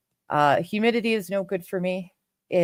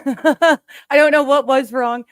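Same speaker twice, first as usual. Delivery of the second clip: laughing; 6 semitones higher, 7 dB louder than usual. Real, not acted. Laughs at fixed problem.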